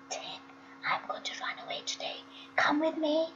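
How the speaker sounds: fast unvoiced whisper in his ear. A narrator's reading. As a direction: breathe it secretly to a friend. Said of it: "whispered in his ear".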